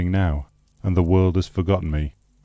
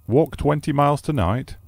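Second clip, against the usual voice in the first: much higher, astonished "Walk twenty miles tonight?"; incredulous repeat; 8 semitones higher than usual. Acted. Host repeating the guest's plan in astonishment. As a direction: throw the words back in disbelief. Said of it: "repeated my host".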